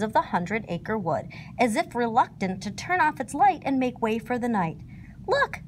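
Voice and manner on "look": high little chuckled Look; chuckle. A narrator's reading. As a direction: chuckle the word out brightly. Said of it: "chuckled Winnie the Pooh".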